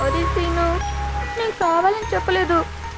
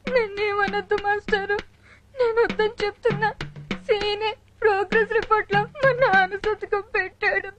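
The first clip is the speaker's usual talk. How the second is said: tearful confession. Acted. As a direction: confess through frightened sobs. Frightened girl tearfully tells on friend. (భయంతో ఏడుస్తూ నెమ్మదిగా చెప్పడం)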